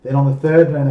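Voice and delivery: usual speaking voice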